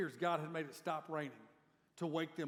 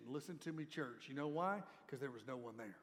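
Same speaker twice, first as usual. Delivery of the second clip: much softer, lower and grave; solemn hush; 4 semitones lower, 7 dB quieter than usual. Real, not acted. Preacher quietly driving home the point to church. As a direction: lean in and say it quietly.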